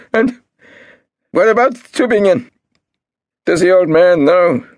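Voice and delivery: high, loud and frantic; shrill panicked questions. A narrator's reading. Marcus demanding answers from Golda, shrill. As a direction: keep screeching.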